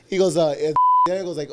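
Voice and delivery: usual speaking voice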